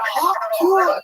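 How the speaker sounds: high-pitched mock crowd yell; high, playful imitation of a shout; imitated yell. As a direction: holler it out like her onstage catchphrase. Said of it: "and she yells out".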